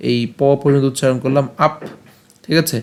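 ordinary talk, nothing marked

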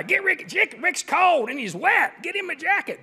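high mock yell at the equipment guy; storming yell. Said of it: "storms down the sideline yelling".

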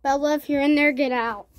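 loud call into the room; calling out loudly. Real, not acted. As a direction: call out to the hider.

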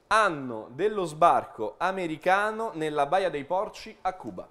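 ordinary talk

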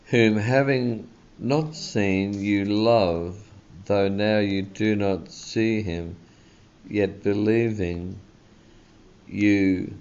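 slow, swelling preacher's reading of the verse; slow, heartfelt reading from the pulpit; fervent scripture reading. Acted. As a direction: lift the verse up slowly like a hymn. Preacher reads scripture with fervour.

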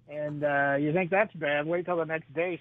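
ordinary talk

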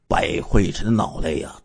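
usual speaking voice

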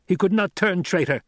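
high, loud snarled denial. A narrator's reading. Captor defending the medic's honour, snarling. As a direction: reject it with a snarl. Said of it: "Gurney snarled".

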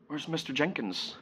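hushed, innocent bedtime question; questioning child. Acted. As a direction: ask softly like a little child. Child's question voiced in monologue.

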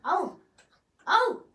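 high, loud alarmed cry; startled shout. Real, not acted. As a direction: yelp in sudden alarm. Sudden fight in game.

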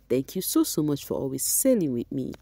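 ordinary talk, nothing marked

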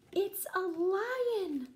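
high, loud, slow alarmed cry; alarmed shout. Acted. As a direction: shout it out like you're scared. Lion revealed.

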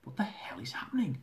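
tense whisper. Acted. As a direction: hiss it under your breath. Panicked aside in station.

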